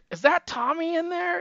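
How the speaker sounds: lightly imitated girls, pitched high; mock girls' voice. Laughing girls asking who is peeking, lightly mimicked. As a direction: raise it high like a curious girl.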